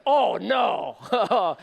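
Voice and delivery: big drawn-out oh no; loud, hammed-up dismay; mock dismay. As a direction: groan it out like you've been played. Dad's retold mock dismay.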